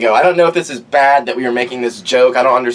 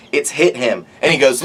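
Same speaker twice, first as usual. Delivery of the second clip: ordinary delivery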